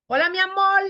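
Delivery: high, loud "amor" as amol; lambdacism. Shown as a demonstration. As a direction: call it out with "amor" ending in l. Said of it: "No es mi amor".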